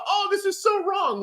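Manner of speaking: high-pitched mock complaint; mock outrage. Mimicking people who say it's wrong, high mocking voice. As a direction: parrot the objectors in a high whine.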